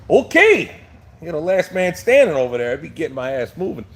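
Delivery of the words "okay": surprised okay. Real, not acted. Reacting to a viewer's odd name.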